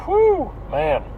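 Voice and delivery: high breathy whew; relieved whew. Real, not acted. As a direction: let out a big whew. Long exhale while driving.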